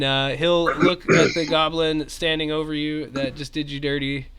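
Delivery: usual speaking voice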